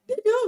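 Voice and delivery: loud, high punch on DO; emphatic insistence. Real, not acted. Insisting against imagined doubters.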